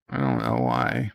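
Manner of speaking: I don't know why dropped very low and soft; mock deep voice. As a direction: rumble it like a goofy giant. Repeats line in a silly voice.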